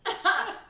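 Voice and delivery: loud, high real laugh; burst of laughter. Real, not acted. Laughs at being asked her feelings.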